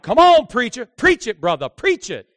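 loud, high call-and-response cries; church women calling out. Retold or imitated. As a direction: shout encouragement from the pews. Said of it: "the women get the hankies out".

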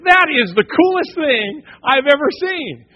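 high, loud, slow awestruck praise; amazed. Retold or imitated. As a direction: marvel at it like a kid. Awed at the demo.

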